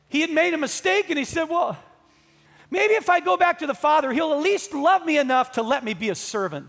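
loud, high storytelling from the pulpit; fervent, urgent preacher's retelling; impassioned preaching. Acted. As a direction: voice the prodigal's plea at full preaching force.

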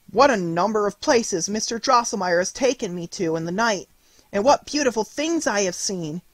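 put-on a child bursting to tell her mother; excited cry. A narrator's reading. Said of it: "cried Marie".

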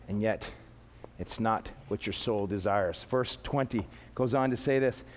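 low, soft, sober delivery; hushed earnest preaching. Real, not acted. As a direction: bring the sermon down to a quiet point.

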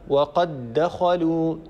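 وقد دخلوا with the two dals merged into one doubled dal, recited slowly; slow recited example. Shown as a demonstration. Teacher reciting وقد دخلوا slowly for students, dal merged. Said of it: "وقد دال ساكنة".

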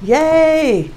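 high, loud excited squeal. Real, not acted. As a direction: squeal it out with delight. Tarot reader excited at card.

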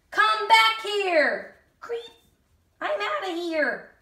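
high, slow come-back call; shouting chase. Acted. Giant and escapers during the chase, high. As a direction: call after the thief.

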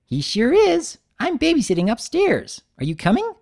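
high, eager, bouncy pony voice; bubbly Pinkie voice. Acted. Pinkie inviting Big Mac upstairs, high and bubbly. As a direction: chirp it up high like an excited party pony.

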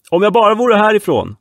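loud, high threat; threatening. Acted. Man on the yacht threatening the boys. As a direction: hurl a threat you can't back up. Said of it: "hotade han".

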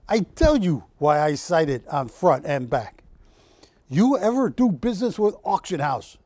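put-on light, half-hearted Russian accent for the painter; a hint of Russian accent, lightly voiced; attempted Russian accent. Host quoting painter Oleg, weak Russian accent. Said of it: "I can't do a Russian accent".

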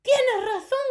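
high, loud witch cry; shout. A narrator's reading. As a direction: screech agreement. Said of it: "gritó otra voz".

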